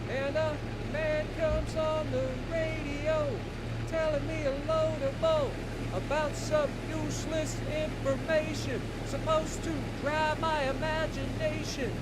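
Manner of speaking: high falsetto singing voice; girl voice. Pretend. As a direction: sing it in a high put-on girl voice. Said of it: "my girl voice sounds horrific".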